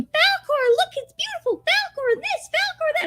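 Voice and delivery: very high put-on squeal; gushing mock-child falsetto, all on one high note; Bastion. Imitating boy calling to Falcor while flying, high squealing mock excitement. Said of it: "he keeps going".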